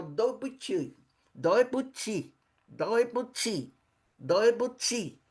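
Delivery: doi buti said slowly, each repeat spaced; slow Mandarin drill. Shown as a demonstration. Teacher slowly repeats sorry, doi buti, for students. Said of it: "Que é o desculpe-me".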